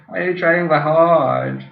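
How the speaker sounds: ordinary delivery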